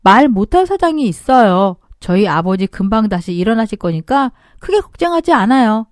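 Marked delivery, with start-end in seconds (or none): none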